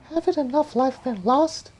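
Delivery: put-on high falsetto girl voice; squeaky high-pitched heroine; Mulan voice.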